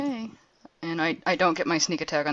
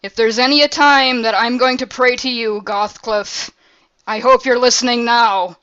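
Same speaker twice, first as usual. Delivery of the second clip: loud, raised-pitch desperate prayer; yelling; 6 semitones higher, 10 dB louder than usual. Acted. Player praying to a god at the ceiling, full yell. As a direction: call it out forcefully to someone far above. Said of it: "turn my head to the ceiling and I yell".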